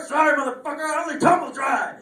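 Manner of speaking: high-pitched smug retort; cocky high punchline. Pretend. Karthik answering his angry friend, a high cheeky retort. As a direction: fire the comeback up high, cheeky.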